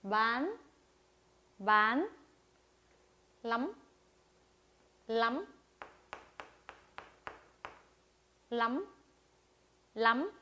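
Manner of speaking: 만 and 람 each gliding upward; rising tone. Shown as a demonstration. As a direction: lift the end of 만 and 람 like a question. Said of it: "이 모양 그대로 올려주시면 되는데".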